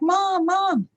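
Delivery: loud, very high calling; imitated kids' yelling. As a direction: mimic excited kids hollering for their mother. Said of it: "all of us kids were yelling".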